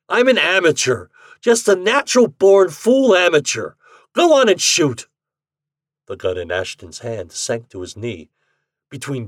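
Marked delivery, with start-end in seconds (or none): 0.1-5.1 high, loud, furious outburst; ringing rage. A narrator's reading. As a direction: rage at him defiantly. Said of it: "His voice rang with rage".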